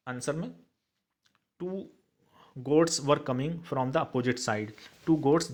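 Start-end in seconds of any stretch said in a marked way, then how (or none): 2.7-5.5 slow dictation. Shown as a demonstration. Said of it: "teacher dictates English answer".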